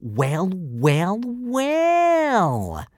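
long drawn-out words climbing very high; mocking drawl. A narrator's reading. Bully sauntering over to his victim, drawling. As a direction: drawl it with rising, gloating relish. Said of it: "drawled Snotlout".

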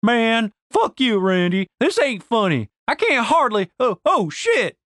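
high-pitched protest climbing into alarm; rising indignant complaint; annoyed, then alarmed. Acted. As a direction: grumble at a friend, then panic as balance goes. Denise protests laughter, starts toppling.

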